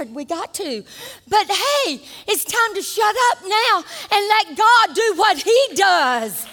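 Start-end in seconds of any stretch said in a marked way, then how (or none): 1.3-6.5 preaching shout. Acted.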